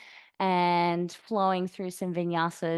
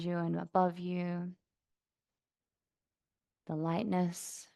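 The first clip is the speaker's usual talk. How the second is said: soft, even meditation voice with long pauses; guided meditation; 4 dB quieter than usual. Acted. Yoga teacher guiding class to notice sounds around them, calm meditation voice. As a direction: settle them gently into stillness, unhurried.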